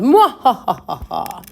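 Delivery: high cackling villain laugh; evil laugh. Pretend. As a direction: throw back a big mwahaha. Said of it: "we're gonna do a nice evil laugh".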